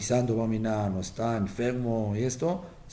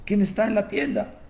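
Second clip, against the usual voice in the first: high mock yell from the sickbed; a miserly father cries out about his shop; retold shout; 8 semitones higher than usual. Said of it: "Y empieza a gritar". (grito exaltado y agudo de un padre avaro)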